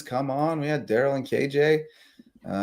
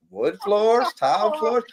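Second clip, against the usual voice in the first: higher, put-on Southern accent; Bubba Gump Southern voice; 8 semitones higher, slower than usual. Host mimics sponsor Chris rattling off floors in a higher Southern accent. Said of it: "sounds like Bubba Gump talking about shrimp".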